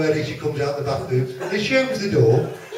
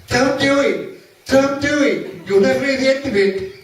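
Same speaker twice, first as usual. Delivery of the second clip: high, urgent comic cry; mock screaming; 7 semitones higher, 3 dB louder than usual. Chicken warning the turkey off the road, mock screaming. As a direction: cry out in hammed-up alarm, pitched high. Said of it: "screaming top of its voice".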